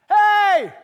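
sudden high, loud shout; mock yell. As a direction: bark it out like calling a stray. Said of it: "does Jesus have to yell".

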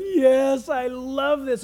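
loud, exulting outburst on the first word; gloating Satan. Acted. Satan relishing the crowds worshiping the Antichrist, gloating. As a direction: crow it with relish. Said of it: "Satan back there behind is gonna be going".

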